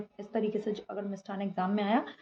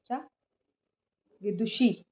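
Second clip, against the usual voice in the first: normal talking voice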